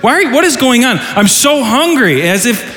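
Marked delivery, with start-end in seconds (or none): 0.0-1.0 exasperated parent. Retold or imitated. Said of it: "I've had my kids before so mad". 1.2-2.3 put-on whiny hungry kid. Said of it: "I've had my kids before so mad and frustrated".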